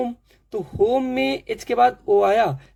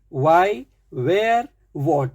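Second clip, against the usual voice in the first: normal talking voice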